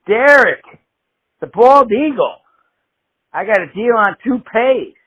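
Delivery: normal talking voice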